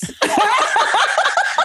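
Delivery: high, breathy laugh; big laugh. Real, not acted. Guest laughing at rival team jab, high laugh. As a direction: cackle at the joke.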